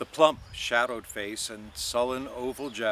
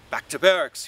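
mock-stern, louder order; a playful stern command; 4 semitones higher, 7 dB louder than usual. A narrator's reading. Buck Mulligan ordering Stephen, mock stern. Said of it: "he said sternly".

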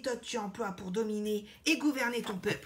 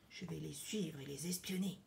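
low, whispered spy voice; secretive whisper; 5 semitones lower, 8 dB quieter than usual. Acted. Crocodile planning to spy on the king, whispering. Spies secretly announce they will follow.

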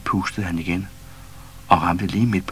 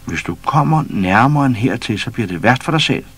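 louder, higher stern threat; firm warning; 5 semitones higher, 5 dB louder than usual. A narrator's reading. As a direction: draw the line. Pus warns the lion.

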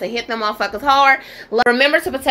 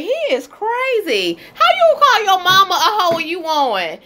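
incredulous; 7 semitones higher than usual. Real, not acted. Reacting in disbelief to a clip.

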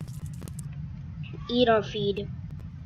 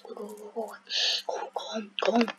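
whispering; 3 semitones lower, 6 dB quieter, much faster than usual. Real, not acted. Urging a unit home.

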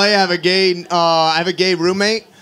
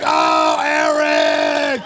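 shocked shout; 6 semitones higher, much slower than usual. Retold or imitated. Recognizing the roommate by his boots, shocked shout. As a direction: shout out the name in disbelief.

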